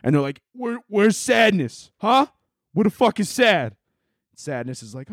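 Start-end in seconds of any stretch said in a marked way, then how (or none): 0.5-3.8 high-pitched mobster demanding answers; Italian mobster. Acted. Mobsters hunting for sadness, menacing wiseguy voice. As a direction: play a cartoon mob heavy, pitched up. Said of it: "big-ass Italian mobsters".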